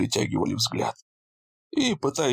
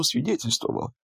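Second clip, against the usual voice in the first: usual speaking voice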